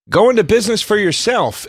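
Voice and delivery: imitating an upbeat business-opportunity ad; newspaper ad pitch. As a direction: sell it like a cheesy small ad. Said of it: "when you answer the ad in the paper, and it's like".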